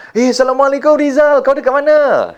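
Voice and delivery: high, hearty phone greeting; excited friend calling. Acted. Best friend rings Rizal, excited and high-pitched. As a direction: hail your best friend in a high, lively voice. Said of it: "kawan baik kita call".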